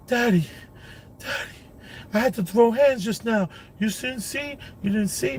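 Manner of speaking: put-on high voice of an excited young son; imitating his son, high and eager; son's voice. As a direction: pitch up to play the boy breathlessly telling dad. Father voicing young son's words.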